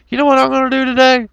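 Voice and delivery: squeaky mocking impression of a troublemaker; mock gleeful schemer. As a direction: play the giddy hoaxer plotting mischief. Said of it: "and say".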